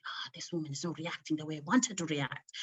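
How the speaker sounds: hushed, quick whisper of the husband's thoughts; whispered inner voice. Retold or imitated. Whispering what the husband thinks. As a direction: whisper his scheming thought fast.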